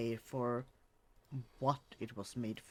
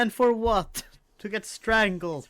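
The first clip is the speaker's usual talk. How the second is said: loud, high outraged protest; indignant; 7 semitones higher, 11 dB louder than usual. Real, not acted. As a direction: cry out at the injustice. Outraged over dog's fate.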